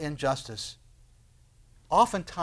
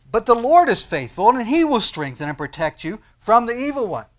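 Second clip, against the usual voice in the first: loud, raised scripture declaration; emphatic proclamation; 6 semitones higher, 8 dB louder than usual. Acted. Preacher reading verse three to congregation, emphatic proclamation. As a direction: proclaim the promise with preacher's force.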